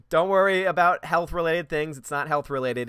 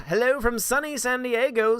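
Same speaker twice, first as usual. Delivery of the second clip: high mock greeting; 9 semitones higher, 4 dB louder than usual. Reading a viewer's greeting aloud.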